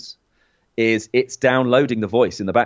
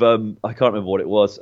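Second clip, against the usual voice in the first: ordinary delivery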